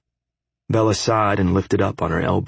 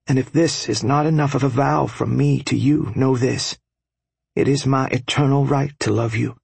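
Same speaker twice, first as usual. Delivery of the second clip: higher, sincere and fervent; earnest young wife; 5 semitones higher than usual. A narrator's reading. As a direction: vow it with passion. Bella's heartfelt vow.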